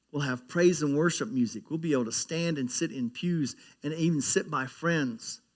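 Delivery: normal talking voice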